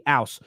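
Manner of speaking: "house" said as 'ouse; dropped H. Shown as a demonstration. Host spelling out his accent, "house" starting on the vowel with no H. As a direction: open "house" on a plain A sound. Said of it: "like with a capital A".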